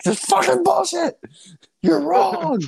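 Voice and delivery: high, strained shouting rant; hammed-up sports fan fury; mock angry rant. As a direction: rage at the screen. Acting out yelling at sports takes.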